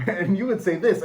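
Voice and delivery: usual speaking voice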